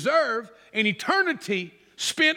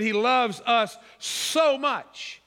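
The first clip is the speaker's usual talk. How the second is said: slow down and lean hard on "so"; "so" drawn out long with heavy emphasis; emphatic SO; 2 semitones higher, slower than usual. Shown as a demonstration. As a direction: stretch "so" for emphasis. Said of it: "show emphasis in regard to".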